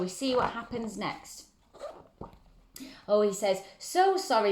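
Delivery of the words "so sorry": high quick apology; apologetic boy. Acted. George apologising to Betty, high and bright. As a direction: sing out a sorry like a cheerful boy.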